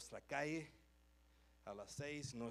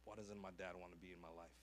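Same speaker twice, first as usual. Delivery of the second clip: sad inner thoughts; 7 semitones lower, 9 dB quieter than usual. Retold or imitated.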